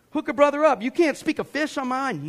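high-pitched, indignant plea; indignant complaint. Retold or imitated. Angry fisherman griping at God, indignant high complaint. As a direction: complain upward in disbelief.

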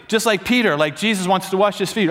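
ordinary delivery

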